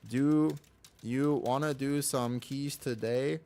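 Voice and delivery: slow casual call to a friend; drawn-out, easygoing invite; unhurried buddy pitch; slow friendly ask. Shown as a demonstration. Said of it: "just start whispering people".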